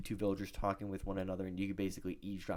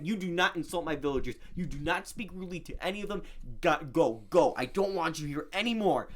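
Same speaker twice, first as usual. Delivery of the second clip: raised, higher-pitched telling-off; mock furious; 6 semitones higher, 4 dB louder than usual. Player orders villager away for insulting friends, mock furious. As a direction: act out an indignant defender banishing a villager. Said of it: "I start furiously attacking".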